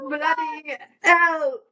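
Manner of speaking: drawn-out, high English-accented exclamation. Shown as a demonstration. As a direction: draw it out loudly in a put-on English accent. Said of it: "talking in an English accent".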